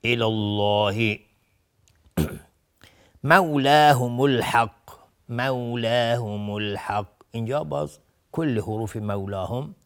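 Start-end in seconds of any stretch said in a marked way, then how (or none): 0.0-1.2 الله with a thick, dark L, slowly drawn out; heavy L. Shown as a demonstration. Teacher demonstrating after fatha, heavy L in الله. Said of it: "تفخیم هست". 3.2-7.1 slow, measured recitation with long vowels; Quran recitation. Shown as a demonstration. Teacher reciting the next words, slow Quran recitation. Recites the verse being taught.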